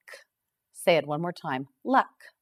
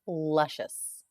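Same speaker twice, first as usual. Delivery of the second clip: usual speaking voice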